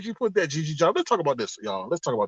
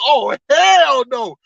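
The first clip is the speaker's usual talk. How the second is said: drawn-out, higher-pitched mock outrage; indignant refusal; 4 semitones higher, 4 dB louder, much slower than usual. Customer reacting to the rude teller, mock indignant. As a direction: stretch out a retold, indignant refusal.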